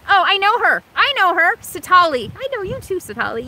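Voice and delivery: light up at a familiar name; excited to know her; excited recognition. Real, not acted. Recognising a YouTuber.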